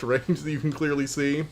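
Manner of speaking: ordinary talk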